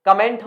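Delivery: comment with a short schwa first, kuh-MENT; common mispronunciation. Shown as a demonstration. Teacher asks students which comment is right, common mispronunciation. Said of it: "कमेंट नहीं कमेंट".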